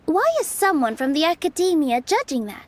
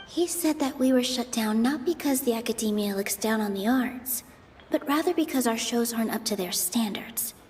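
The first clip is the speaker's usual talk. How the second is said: softer, self-steadying inner voice; quiet inner thoughts; 4 semitones lower, 8 dB quieter than usual. Acted. Nilu quietly steadies herself mid-debate, softer inner voice. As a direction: talk yourself down quietly, thinking it through.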